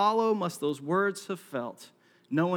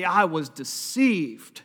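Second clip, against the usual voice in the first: mock whining complaint; 6 dB louder, much slower than usual. Seller complaining years later.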